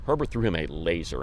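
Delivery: ordinary talk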